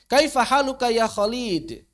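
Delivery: "Kaifa haluka ya Khalid" with short "Kha"; high, slow "ya Khalid", stretching the wrong syllable; misplaced mad. Retold or imitated. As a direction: imitate neglected long vowels in "Khalid". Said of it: "madnya nggak diperhatikan".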